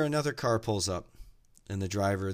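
ordinary talk, nothing marked

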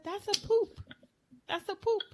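high little voice playing dumb; imitated innocent child. Child answering grandmother about the poop, imitated innocence. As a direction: pitch it up like a kid feigning innocence.

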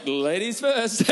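drawn-out, slow "ladies first"; slow mock gallant. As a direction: stretch it out slowly, pushing her ahead. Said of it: "I'm like".

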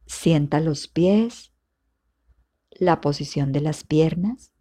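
normal talking voice

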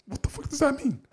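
high, disbelieving question; baffled outburst; incredulous. Real, not acted. Reacting to the statement.

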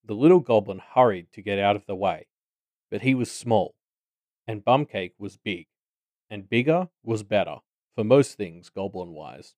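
ordinary delivery

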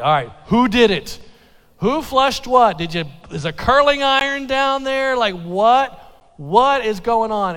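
loud mock-stern questioning of the girls; hammed-up loud interrogation. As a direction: demand answers like a dad on the warpath. Dad interrogating daughters, inquisition.

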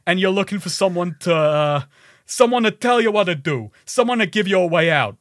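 rally the crowd in a badly faked accent; Jack Paris. Game rebel telling people to save themselves, bad accent. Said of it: "my bad accent".

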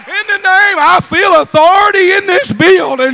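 full-force preaching. Real, not acted. Preacher urging a church to hold to apostolic truth, full-force preaching. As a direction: preach it hard, punching the key words.

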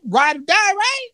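squeaky wheedling 'ride or die'; high mock-girlfriend plea; coaxing Eve.

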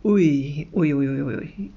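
low, teasing repeated ui; playful oh-oh-oh; knowing ui ui ui. Real, not acted. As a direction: anticipate the chaos with mock alarm. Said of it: "das kann ich mir vorstellen".

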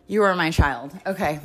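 ordinary delivery

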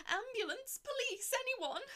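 high desperate screaming for help; screams for help. A narrator's reading. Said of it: "screams for help".

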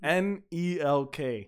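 slow, separate letters; spelling out slowly. Real, not acted. Host answering co-host who asked the name, slowly spelled. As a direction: give it one letter at a time.

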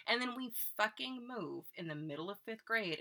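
ordinary talk

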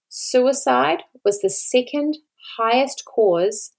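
each word laid down slowly and heavily; slowed right down to stress how serious it is; slow, weighted emphasis. Real, not acted. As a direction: let the statistic land, word by word. Grave statistic, slowed for weight.

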